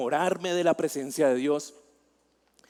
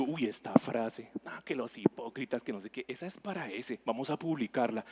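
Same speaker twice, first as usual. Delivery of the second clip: scheming aside; 5 semitones lower, 9 dB quieter than usual. Retold or imitated. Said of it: "Yo tenía algo en contra".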